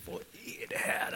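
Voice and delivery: viskad undrande tanke; förbryllad viskning. Retold or imitated. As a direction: viska som en förvirrad nyfrälst. Retold thought, confused newcomer. (viskad tanke, förbryllad)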